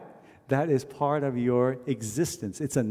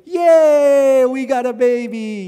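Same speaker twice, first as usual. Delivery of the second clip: much higher, louder joyful yay; excited cheer; 10 semitones higher, 12 dB louder, slower than usual. Retold or imitated. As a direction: cheer like the whole family celebrating. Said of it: "And everyone's going".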